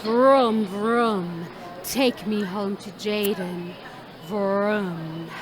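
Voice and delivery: low, drawn-out engine-like purr; seductive toy car. Acted. Toy car calling out to a mom from the shop shelf, seductive. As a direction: vroom it slowly and low, luring the buyer. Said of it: "its seductive call saying".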